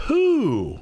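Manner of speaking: high, stretched-out who; high hooting who. Acted. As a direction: call who high and long. Bear's repeated question.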